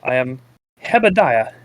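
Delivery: hammed-up Kyrgyzstan accent; mock Kyrgyzstan accent. Playing Hebediah introducing himself, mock Kyrgyzstan accent. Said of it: "This is my Kyrgyzstan's accent".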